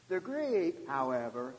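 normal talking voice

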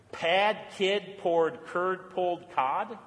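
very slow, word by word; careful tongue twister. Shown as a demonstration. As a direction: pick through each word of a tongue twister slowly. Reads out hardest tongue twister.

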